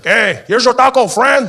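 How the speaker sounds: loud, high, drawn-out call; taco vendor. Acted. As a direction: holler it out from the truck. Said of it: "comes back and he's like".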